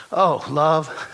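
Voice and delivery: ordinary delivery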